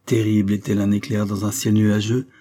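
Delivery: usual speaking voice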